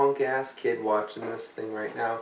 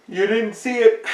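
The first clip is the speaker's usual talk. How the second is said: much higher, put-on dismissive voice; mocking sigh voice; 10 semitones higher, 6 dB louder than usual. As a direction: scoff like a know-it-all. Mocking sceptic voice.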